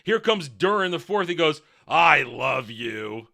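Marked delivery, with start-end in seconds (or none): 1.9-3.3 low, drawn-out declaration; mock lovesick husband. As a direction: stretch it slowly in a low, dopey voice.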